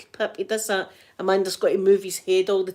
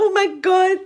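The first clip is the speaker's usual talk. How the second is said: high, loud gasp of delight; gushing; 10 semitones higher, 5 dB louder than usual. Retold or imitated. Granny retelling her gush over the smiling baby, high and loud. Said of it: "I'm like".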